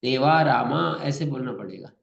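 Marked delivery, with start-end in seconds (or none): 0.0-0.8 देवा रामा with the final आ held long and open; open-throat vowels. Shown as a demonstration. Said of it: "गले को पूरा खोलना पड़ेगा". (गला पूरा खोलकर देवा रामा)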